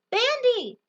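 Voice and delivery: high, loud call after the runaway dog; shouted name. Acted. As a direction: yell his name as he leaps away. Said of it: "I shouted for him".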